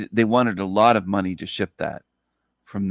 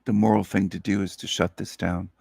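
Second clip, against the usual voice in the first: normal talking voice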